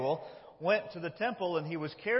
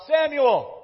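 loud, high call of the Lord; 11 semitones higher, 12 dB louder than usual. Acted. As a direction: shout the name as if summoning someone. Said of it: "The Lord called out".

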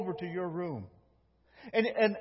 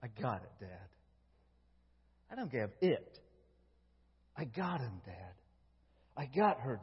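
soft, low, repeated reassurance fading away; tender whisper-soft; 8 semitones lower, 11 dB quieter than usual. Retold or imitated. Jesus softly telling his heavenly Father he's got us, tender and hushed. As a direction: murmur it gently, again and again.

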